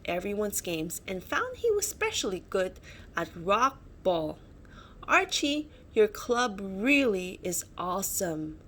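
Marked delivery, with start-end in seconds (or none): none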